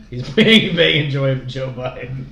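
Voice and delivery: loud, high and giddy; loud giddy burst of a joke; excited laughing joke. Real, not acted. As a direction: blurt it out laughing.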